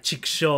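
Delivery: "Chikusho" blurted high and loud; exclaimed Japanese curse. Pretend. Hosts suggesting a frustrated cry, "Chikusho" shouted. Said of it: "say, like".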